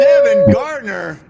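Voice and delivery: very high, slow, loud emcee voice; host bigs up the Emmy winner, high and drawn out; announcer hype. Acted. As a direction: introduce him like a boxing announcer.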